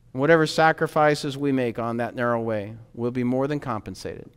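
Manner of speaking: usual speaking voice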